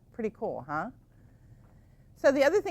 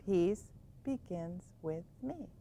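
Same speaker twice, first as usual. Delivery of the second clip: softer and slow; soft mantra; 2 semitones lower, 7 dB quieter, slower than usual. Shown as a demonstration. Teacher showing the bedtime mantra, softly. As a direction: murmur it slowly to settle. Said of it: "It's hard to settle down".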